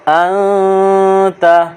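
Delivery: ان تا with the نون hidden in a long nasal hum; ikhfa on ان. Shown as a demonstration. Teacher showing the ikhfa to students. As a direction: hide the نون of ان in the nose before تا. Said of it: "یہاں اخفا کریں گے".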